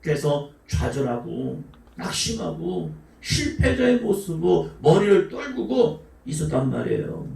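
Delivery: normal talking voice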